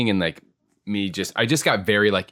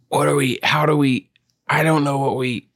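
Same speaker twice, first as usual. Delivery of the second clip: higher, louder, stumbling over half questions; flustered confusion; 8 semitones higher, 4 dB louder than usual. Retold or imitated. Improviser reliving freezing up with his partner, flustered and higher. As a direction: sound lost for words mid-scene. Retelling being lost on stage.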